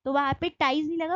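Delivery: usual speaking voice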